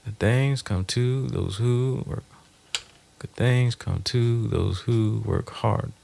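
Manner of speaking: slow, counting each beat; slow iambic test. Shown as a demonstration. Checking if the motto fits iambs, slowly. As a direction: say it slowly, weighing each stress. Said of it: "maybe not".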